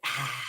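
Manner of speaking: a low, soft breathy "ah" into the mic; heavy mic breath. Retold or imitated. Mocking the noise on the call with a low mic breath. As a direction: imitate someone breathing on a mic. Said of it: "somebody breathing on a mic".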